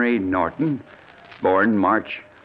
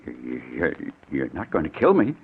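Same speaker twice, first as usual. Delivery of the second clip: frightened stammer, softer at the start; stammering fear; 9 semitones lower, 4 dB quieter than usual. Acted. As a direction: stumble over the words in fear. Victim fears being killed.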